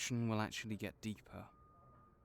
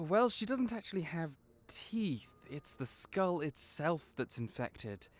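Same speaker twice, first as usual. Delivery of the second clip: usual speaking voice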